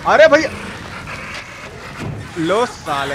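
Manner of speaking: ordinary talk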